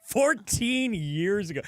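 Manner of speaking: slow, loud and laughing. Real, not acted. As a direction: laugh it out, drawn out.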